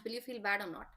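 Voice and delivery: ordinary talk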